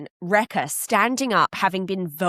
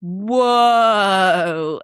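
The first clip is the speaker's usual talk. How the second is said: long, drawn-out, louder whoa; stunned whoa; 5 dB louder than usual. Retold or imitated. Everyone at the round table reacting in shock, drawn-out whoa. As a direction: let out a slow, astonished whoa. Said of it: "And everyone is just like".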